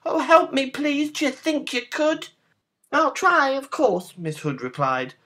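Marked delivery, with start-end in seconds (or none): 0.1-2.3 high, pleading piggy squeal; pig crying out. A narrator's reading. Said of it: "Pig cried". 2.9-4.2 put-on high, loud girlish reply. A narrator's reading. Girl agreeing to help pig, high voice.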